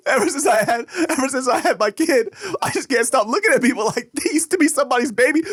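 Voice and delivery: cousin crying. Retold or imitated. Cousin explains why he's crying, high tearful imitation. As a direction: mimic a new dad sobbing his reason. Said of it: "He goes".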